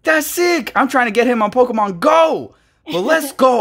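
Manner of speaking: high, loud happy shouts; excited. Real, not acted. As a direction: cheer over the big pull. Pulled a favourite card.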